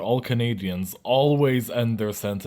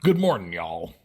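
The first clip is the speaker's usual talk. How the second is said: mock American; 3 semitones lower than usual. Shown as a demonstration. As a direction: declare it as a caricature American, dropping the G. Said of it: "the result of our translation will be this".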